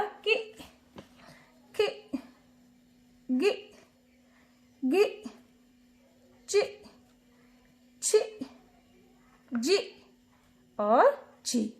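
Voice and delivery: teacher's drill, long pause after each syllable; isolated short syllables from कि to ची, evenly spaced out. Shown as a demonstration. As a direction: recite कि, गि, चि, छि one by one, short i. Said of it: "तो क्या बन गया?".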